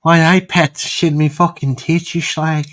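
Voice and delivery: high, put-on Geordie accent. Showing friends the Geordie accent on request, mock Geordie. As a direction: do a crude, hammed-up Geordie impression. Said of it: "the Geordie accent's more".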